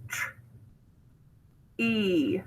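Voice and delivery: "tr-" then, after a long pause, "E" as EE; sounded out. Shown as a demonstration. Teacher spelling tree, "tr-" and "E" sounded out apart. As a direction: separate the blend "tr-" from the long "E". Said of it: "final position".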